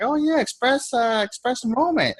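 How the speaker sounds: high pitched mock upline; imitating the upline. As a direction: play the knowing upline. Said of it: "she's gonna be like".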